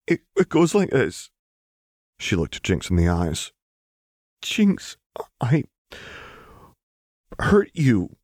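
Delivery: nervous start, then steady heartfelt confession; Lux apologizing. A narrator's reading. Lux voiced apologizing to Jinx.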